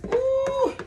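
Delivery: delighted ooh. Real, not acted. Tasting the combined flavors.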